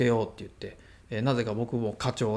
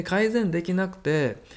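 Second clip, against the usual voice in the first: ordinary talk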